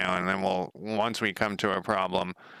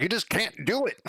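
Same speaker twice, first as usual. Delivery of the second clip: much higher, mocking know-it-all voice; mocking the forum guy; 11 semitones higher than usual. Retelling a forum commenter's blunt answer to him, mocking high voice. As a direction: parrot him back in a high, scornful squeak. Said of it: "he was just like".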